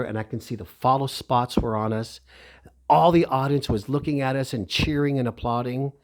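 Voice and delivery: ordinary delivery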